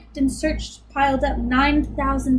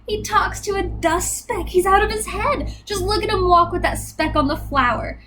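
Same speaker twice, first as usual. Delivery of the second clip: mocking jungle gossip; 6 semitones higher than usual. Jungle animals jeering at Horton.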